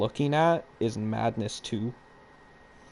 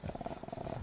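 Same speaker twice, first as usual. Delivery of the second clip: whispering while hiding; 8 semitones lower, 15 dB quieter than usual. Real, not acted. Hiding from the killer.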